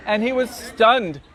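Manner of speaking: ordinary delivery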